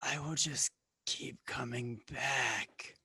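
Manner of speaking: rasping, wheezing creature. Acted. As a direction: warn them in a wheezy creature voice. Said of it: "rasping, wheezing voice".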